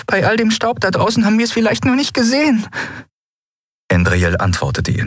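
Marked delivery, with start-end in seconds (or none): 0.1-2.7 very high, quick character voice; hopeful character voice. Acted. Companion explaining they may have missed him, high and quick. Narrator voices Nelen offering hope.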